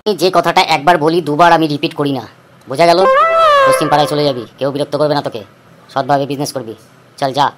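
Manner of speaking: a strongman dismissing the cheat; stern village boss. Acted. Boss Phuto dada repeats verdict.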